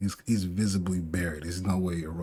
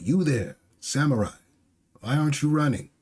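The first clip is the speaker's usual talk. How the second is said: high, raised calling voice; 6 semitones higher, 4 dB louder than usual. Acted. Raizo hails a fleeing samurai, calling out urgently. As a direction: hail someone sharply from the floor.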